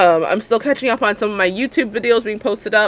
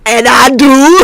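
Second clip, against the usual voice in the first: loud, high, drawn-out yell; gleeful shout; 5 semitones higher, 13 dB louder, slower than usual. Real, not acted. Host answering the weed jingle, gleeful shout. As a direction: belt it out proudly before laughing. Shouting along with meme sound alert.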